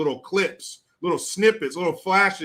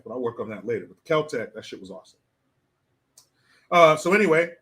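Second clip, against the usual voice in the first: usual speaking voice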